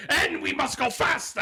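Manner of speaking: mock Willy Wonka. Host mocking cohost as Willy Wonka, hammed-up. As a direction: play a manic Wonka urging speed. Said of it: "he's just going".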